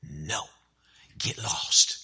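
hushed, breathy "get lost"; whispered refusal. Acted. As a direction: whisper it so the kids stay asleep. Neighbor refuses through window.

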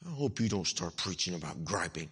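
low, hushed mock grumble; listener's silent gripe, imitated under the breath; inward grumbling thought. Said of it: "in your heart right now think".

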